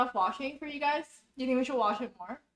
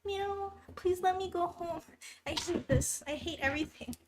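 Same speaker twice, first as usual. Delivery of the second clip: lightly voiced whiny cat, a little higher; cute cat voice; 6 semitones higher, 3 dB quieter than usual. Voicing the cat who wants to go home, a cute whiny meow voice. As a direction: give the cat a small, pitiful pleading voice. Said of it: "hear her saying meow, like, really cutely".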